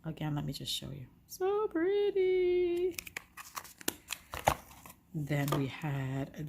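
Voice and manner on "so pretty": high, slow, delighted coo. Real, not acted. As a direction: draw it out, way up high. Cooing over a pretty item.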